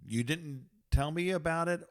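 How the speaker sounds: normal talking voice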